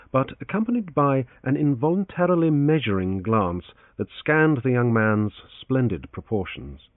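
ordinary delivery, nothing marked

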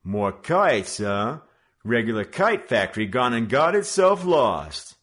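loud, high sarcastic tough; gang leader mocking. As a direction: rib them over the haul. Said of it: "he remarked".

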